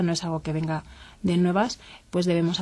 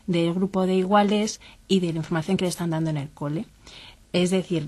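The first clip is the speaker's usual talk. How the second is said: ordinary talk, nothing marked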